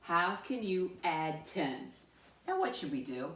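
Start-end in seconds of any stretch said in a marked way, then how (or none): 0.0-2.1 slow, evenly spaced choral-reading pace; slow read-along. Shown as a demonstration. Teacher leads pupils reading the question together, slow read-along. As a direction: read slowly so others can join in. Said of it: "Read it with me this time".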